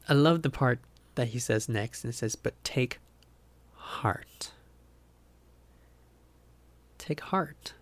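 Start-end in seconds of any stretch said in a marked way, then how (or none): 2.4-4.5 heart fades to a whisper; softly read, heart whispered; hushed verse. Acted. Reading the verse, fading. 7.0-7.7 tender, softer take heart; quiet reassuring repeat; soft echo. Acted. Repeating the phrase softly.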